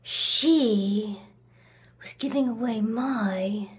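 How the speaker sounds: tight, controlled grievance; restrained resentment. Acted. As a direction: hold the anger in, dwelling on each word. Holding back the fury.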